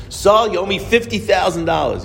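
an angry father calling out Saul over the money; irate caller. Retold or imitated. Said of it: "I have an irate guy screaming".

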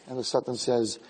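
ordinary talk

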